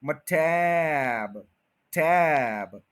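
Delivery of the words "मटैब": मटैब drawn out, leaning on टैब; slow मटैब, टैब held; stretched stress. Shown as a demonstration. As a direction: hold the टैब long. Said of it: "टैब के ऊपर पूरा आप stay करें".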